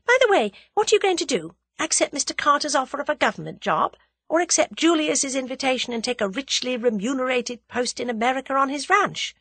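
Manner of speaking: high, lively quizzing; eager heroine, pitched well up; spirited young heroine. A narrator's reading. Voices heroine quizzing Tommy.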